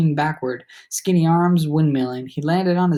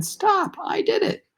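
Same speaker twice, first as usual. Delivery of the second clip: high mock yell, much higher; retold yell; slower than usual. Narrator recalls yelling to stop the fight, a high mock yell. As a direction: replay the yell as if someone else's voice. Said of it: "somebody else's voice yelling".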